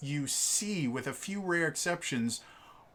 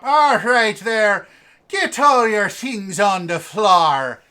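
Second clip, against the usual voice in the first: loud, slow, raised mock-Irish accent; 6 semitones higher, 12 dB louder, slower than usual. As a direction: bellow like a caricature Irish policeman. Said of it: "Irish cop".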